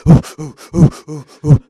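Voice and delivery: loud, high rhythmic "ooh" puffs; imitated hard puffing, pushed high; mimed running puffs. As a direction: mimic a runner huffing on and on. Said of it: "he was just there going".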